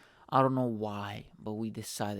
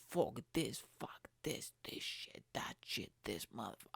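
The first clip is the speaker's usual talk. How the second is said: whispered mock scream; soft, breath-only imitation of screamed cursing; 9 dB quieter than usual. Child cursing out the ride, screamed under the breath. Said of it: "like, screaming".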